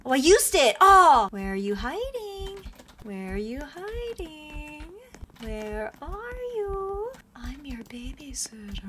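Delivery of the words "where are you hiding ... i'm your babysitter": slow, soft, sing-song hunting call ending in a whisper; creepy babysitter. Pretend. Nanny player calling to hiding kids, creepy and hushed. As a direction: lure the hiding kids out quietly.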